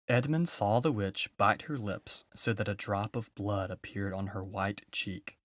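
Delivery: ordinary delivery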